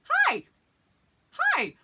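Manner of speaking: very high, loud sing-song hi; pointed greeting. Retold or imitated. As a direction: greet the girl in your spot with fake sweetness. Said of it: "And I'm like".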